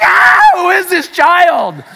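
high, loud, slow laughing outcry; dad laughing in disbelief at his defiant daughter; exasperated shout. Retold or imitated. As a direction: throw up your hands and cry it out through a laugh.

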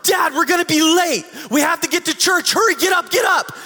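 put-on high, loud excited kids yelling; urgent little kids hurrying parents; children shouting to hurry up. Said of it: "the kids burst into the room and go".

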